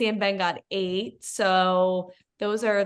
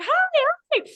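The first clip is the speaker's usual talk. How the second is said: much higher, louder mock-nice "hi"; imitating friendly greeting; 4 dB louder than usual. Co-host mimics nephew's nice entrance, high and friendly. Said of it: "not using a thick Southern accent".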